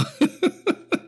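high breaking laugh; teary laugh. Real, not acted. Host laughing while fighting tears over Tony and Peter. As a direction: laugh as if about to cry. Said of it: "I'm about to fucking lose it".

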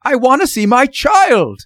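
high, loud desperate demand; almost shriek it in desperation; father at the end of his nerves, shrill and loud; near shriek. A narrator's reading. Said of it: "Mister Button almost shrieked".

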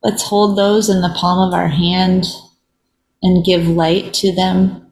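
unhurried meditation voice with long silences; guided reflection. Real, not acted. Host leading listeners in a moment of pause, meditative. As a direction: lead gently, leaving long pauses between phrases.

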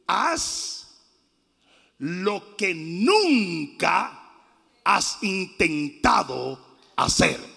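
very slow, low delivery; measured solemn command. Acted. Preacher voices Paul's word to the man, slow measured command. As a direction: weigh each word, with long pauses between phrases. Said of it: "Él le dijo".

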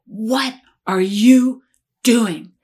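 loud, very slow booming command; slow, loud retold warning from above; imitated loud voice. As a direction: imitate a commanding voice, each word stretched and forceful. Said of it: "this loud voice that said".

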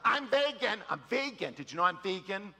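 a hint of a showy vegan; mock loud vegan. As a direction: announce it proudly, lightly mocked. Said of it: "always the loudest people".

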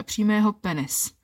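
ordinary delivery

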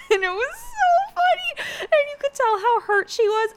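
high, squealing talk through laughter; helpless laughter pushing the words way up high; cracking up. Real, not acted. Vlogger gleefully recapping an awkward reality show moment, cracking up. Cracking up over contestant's hurt.

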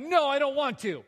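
a grown man whining no at his wife; whiny tantrum. Retold or imitated. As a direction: refuse like a sulking kid. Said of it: "Did I say it that way? Yes".

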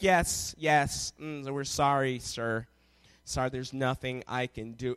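very low, soft, unbudging agent; counter lady. Retold or imitated. Counter agent to an angry passenger, coolly apologetic. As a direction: imitate a quietly unhelpful customer service voice. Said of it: "they've got all the power".

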